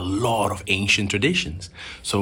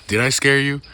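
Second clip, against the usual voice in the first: normal talking voice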